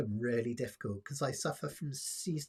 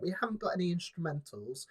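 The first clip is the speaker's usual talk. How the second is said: ordinary delivery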